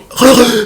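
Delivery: sharp high waking gasp; loud high gasp of fright; startled gasp. Retold or imitated. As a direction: gasp as if jolted awake. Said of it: "you wake up going".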